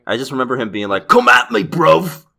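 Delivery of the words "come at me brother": loud, high rough London chav shout; chav accent. Retold or imitated. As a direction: bark it like a cocky London street kid. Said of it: "had a real chav accent".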